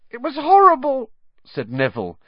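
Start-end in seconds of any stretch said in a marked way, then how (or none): 0.1-1.1 high, loud, frightened wail; higher voice. Acted. Neville telling friends about the Dementor, high scared voice. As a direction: squeak it out, scared and shaken. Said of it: "in a higher voice than usual".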